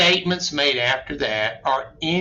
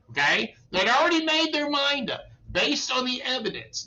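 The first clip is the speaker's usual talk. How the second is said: usual speaking voice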